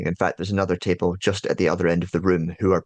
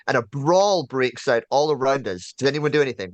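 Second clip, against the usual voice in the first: ordinary delivery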